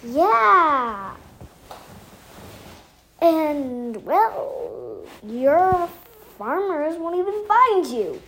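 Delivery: plain reading voice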